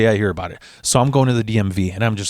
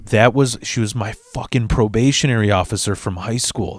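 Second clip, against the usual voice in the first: ordinary talk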